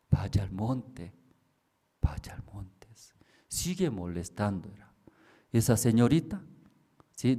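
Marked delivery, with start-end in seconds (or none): 0.1-2.9 slow, soft, whispering order; the hidden voice fading to a whisper; whispered command. Retold or imitated. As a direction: breathe the command from the dark.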